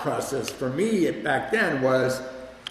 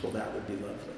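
soft and subdued; the ill mother, quiet on the phone; quiet, hurt mother; 3 semitones lower, 9 dB quieter, faster than usual. Retold or imitated. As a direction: say it quietly, holding back hurt. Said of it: "she just was real quiet".

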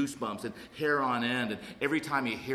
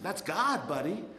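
a hint of a laid-back old-timer; mild sponsor voice; 6 semitones higher, slower than usual. Sponsor explaining to newcomer, lightly voiced, laughing. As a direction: say it plainly, knowingly. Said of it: "he's like".

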